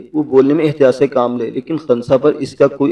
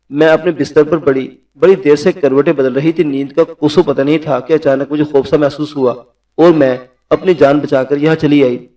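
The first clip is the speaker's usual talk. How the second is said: ordinary delivery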